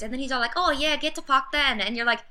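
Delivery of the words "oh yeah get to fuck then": higher, louder mock British street accent; imitating Cook's rough British accent; lightly hammed British accent. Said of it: "Cook has a ridiculous accent".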